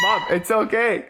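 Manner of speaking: laughing, calling out to mom; laughing shout. Real, not acted. Daughter laughing while calling to her fleeing mom. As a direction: call after her through real laughter.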